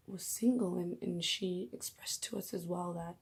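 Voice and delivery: ordinary delivery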